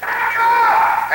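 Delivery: high, sustained cry of a name; drawn-out imitated summons; God calling out. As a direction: call the boy as God would. God calls the sleeping boy.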